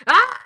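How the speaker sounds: sudden loud high mock scream; granddaughter's scream. Grandma mimics granddaughter's tantrum, loud high scream. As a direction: screech it like a spoiled child. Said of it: "I started screaming back at her".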